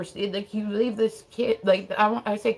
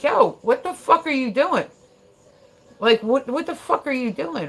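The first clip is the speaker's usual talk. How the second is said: raised, high-pitched challenge; retold outburst at higher pitch; loud confrontation; 8 semitones higher, 6 dB louder than usual. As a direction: call him out loudly, in disbelief. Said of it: "I got loud".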